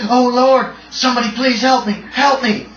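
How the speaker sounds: high, loud, desperate plea for help; terrified yell. Acted. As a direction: yell it like you are running for your life. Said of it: "He's wild-eyed with terror. He yells out".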